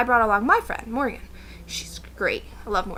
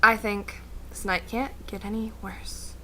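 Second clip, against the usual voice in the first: ordinary talk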